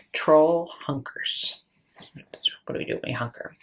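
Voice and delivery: low hushed whisper. A narrator's reading. Narrator whispering to children as troll hides. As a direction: breathe it out, hiding like the troll.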